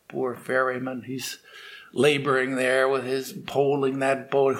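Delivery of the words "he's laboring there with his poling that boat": ordinary delivery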